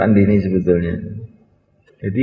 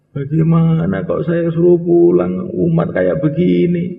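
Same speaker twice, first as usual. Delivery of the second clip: tearful plea; 7 semitones higher, slower than usual. Acted. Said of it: "Pulang tuh nangis".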